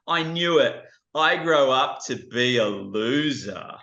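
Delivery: put-on a kid crushed by who he became; dismayed boy. Boy realising his grown-up self is a loser. Boy despairs, hand to head.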